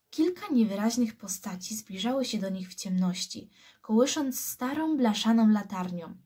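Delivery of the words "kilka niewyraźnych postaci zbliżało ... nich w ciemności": usual speaking voice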